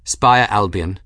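plain reading voice

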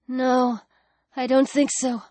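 soft, fading voice; quiet and woozy; faint reply. Acted. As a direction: answer weakly while slipping away. Alex fading, about to faint.

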